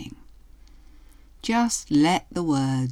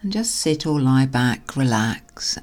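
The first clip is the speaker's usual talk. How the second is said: hypnotherapy voice. Acted. Hypnotherapy induction over music.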